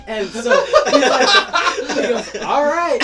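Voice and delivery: high, loud, stretched out through laughter; can barely get it out for laughing. Real, not acted. Telling friends about the chug, laughing helplessly.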